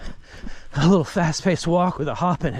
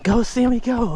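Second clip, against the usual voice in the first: retold gleeful scream; 10 semitones higher, slower than usual. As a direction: cheer the boy on as the stroller rolls. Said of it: "And I'd scream".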